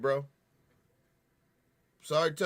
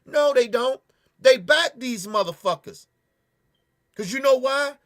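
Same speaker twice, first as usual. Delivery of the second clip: higher and louder, cutting the quote off; loud retort; 5 semitones higher, 6 dB louder than usual. Real, not acted. Answering the mock police defence, loud and angry. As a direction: snap back with real anger.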